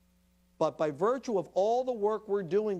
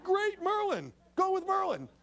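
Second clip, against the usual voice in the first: higher, bright mock cheer; eager agreement. Therapist and parents welcoming Owen's choice, mock eager. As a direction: jump on the idea with mock enthusiasm. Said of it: "They're like".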